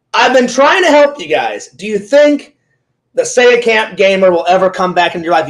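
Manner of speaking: usual speaking voice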